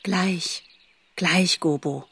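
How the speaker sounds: calm a frightened brother gently; gentle quiet reassurance to a lost brother; soothing. A narrator's reading. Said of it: "Faline beruhigte ihn".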